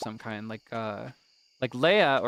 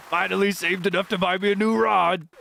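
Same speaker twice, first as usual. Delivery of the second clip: higher-pitched proud fisherman; Willy Wilson's voice; 5 semitones higher, 3 dB louder than usual. Acted. Fisherman showing off a new rod, higher seafarer voice. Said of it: "give Willy Wilson's voice".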